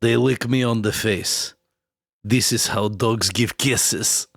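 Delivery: slow, lightly put-on Russian kid accent; a hint of a Russian child's broken English, slowly. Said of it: "I can do a Russian child".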